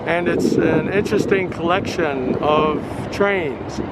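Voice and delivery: talking through a face mask; muffled through mask. Real, not acted. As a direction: speak muffled behind a mask. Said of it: "so if it's sort of muffled".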